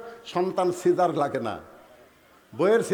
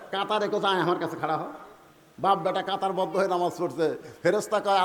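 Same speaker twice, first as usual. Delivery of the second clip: ordinary talk, nothing marked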